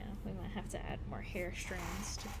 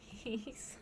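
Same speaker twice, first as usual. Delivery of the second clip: ordinary talk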